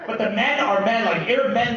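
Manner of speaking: ordinary delivery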